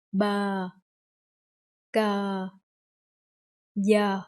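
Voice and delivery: BA, GA, YA each said separately; consonant drill. Shown as a demonstration. Teacher leads the class, BA GA YA modelled one by one. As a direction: space out BA, GA, YA for repeating. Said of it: "let's say three of them together".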